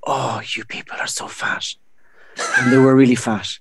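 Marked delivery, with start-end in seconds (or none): 0.1-1.7 whispered. Retold or imitated. Quoted stage opener.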